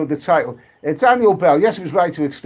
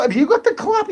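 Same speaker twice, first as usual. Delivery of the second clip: much higher squeaky mock voice, a rising question; silly high voice; 3 dB louder than usual. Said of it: "We'll stop talking like this".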